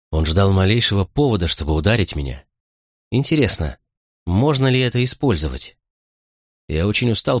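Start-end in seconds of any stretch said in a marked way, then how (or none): none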